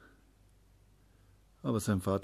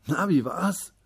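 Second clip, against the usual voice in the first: higher, louder expectant father; keen parent wanting the news; eager question; 9 semitones higher, 6 dB louder, slower than usual. A narrator's reading. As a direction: ask with lively, raised curiosity. Father eagerly greets returning child.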